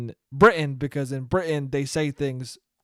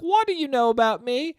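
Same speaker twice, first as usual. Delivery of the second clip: put-on boy voice pitched way up; little James; 10 semitones higher than usual. James the boy asks the narrator, high childish voice. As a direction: squeak it out like a scared kid. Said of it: "And James is like".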